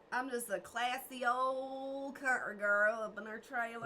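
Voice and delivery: slow, hammed-up country girl accent; mild country accent, slowed right down. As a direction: drawl it like a proud country girl. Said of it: "You do a pretty good country voice".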